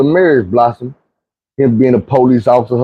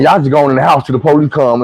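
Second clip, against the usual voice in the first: normal talking voice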